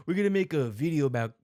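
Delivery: hurried, hammed-up Australian accent; badly faked Australian twang. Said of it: "the worst Australian accent".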